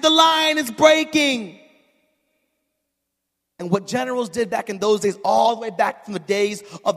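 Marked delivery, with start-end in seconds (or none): 0.0-1.6 put-on slow, high French-accented cry; hammed-up French colonel's warning; big French accent. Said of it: "He's talking his big French accent".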